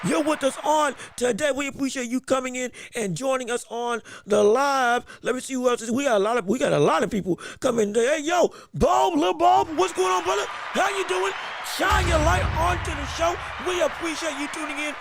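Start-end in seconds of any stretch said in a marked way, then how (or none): 0.0-1.5 upbeat, raised stream-host greeting; higher, bouncy shout-out voice; hype host welcome. Acted. 8.2-15.0 upbeat, raised stream-host greeting; higher, bouncy shout-out voice; hype host welcome. Acted.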